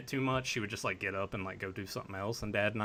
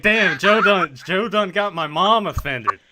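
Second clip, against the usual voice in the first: loud, higher mock exclaim, slowing down; mock outburst; 6 semitones higher, 10 dB louder, slower than usual. Host retelling his reaction to mom, loud mock exclaim. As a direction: blurt it out in playful disbelief. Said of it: "I was like".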